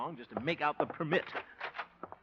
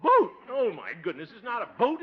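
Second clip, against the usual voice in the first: ordinary talk, nothing marked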